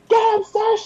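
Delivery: very high squealed cheer. Acted. Player voicing House urging on Saoirse, high excited cheer. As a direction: egg her on in a high-pitched cry. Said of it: "House is just there like".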